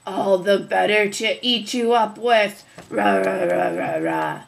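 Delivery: loud growling wolf; wolf pouncing. Acted. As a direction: roar as you pounce.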